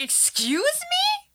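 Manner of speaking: very high, slow, stretched-out disbelief; incredulous. Retold or imitated. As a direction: squeal it as if you can't believe it. Reacting to movie's absurd prayer.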